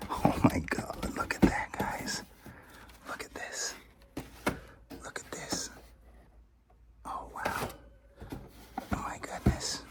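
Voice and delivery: soft, unvoiced gasping whisper; awed whisper. Real, not acted. Collector whispering to viewers at a stunning toy. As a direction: breathe it out in disbelief. Whispering over rare toys.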